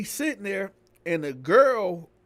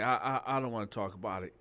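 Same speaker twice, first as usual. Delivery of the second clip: ashamed and quiet; 7 semitones lower, 6 dB quieter, faster than usual. Retold or imitated.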